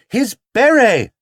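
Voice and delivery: high, loud shout over the noise. Acted. Mrs Brown shouting to the detective over the crowd noise. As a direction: yell it over the din. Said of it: "shouted Missus Brown in return".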